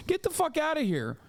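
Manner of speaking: high-pitched dismissive blast; incredulous outburst. Real, not acted. Streamer scoffing at American critics, high incredulous outburst. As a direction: throw the line out in disbelief. Explosive dismissal after the parody.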